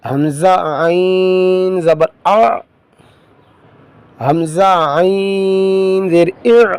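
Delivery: slow, sing-song spelling chant; Qaida recitation drill. Shown as a demonstration. Teacher drilling pupils through Qaida spelling, slow sing-song chant. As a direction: call out each syllable slowly for pupils to repeat.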